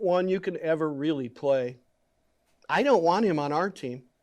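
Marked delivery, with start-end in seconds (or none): none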